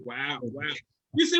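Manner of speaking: ordinary delivery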